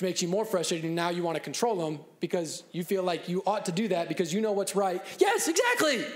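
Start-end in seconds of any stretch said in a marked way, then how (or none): 5.2-6.0 very high, far louder exclaim; delighted child. Retold or imitated. Oldest child to parent, very high delighted. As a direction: squeal like the kid at last understood. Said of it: "child agreeing".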